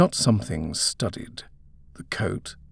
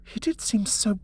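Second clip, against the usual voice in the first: nervous; 7 semitones higher, 3 dB quieter than usual. A narrator's reading. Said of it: "Penny said nervously".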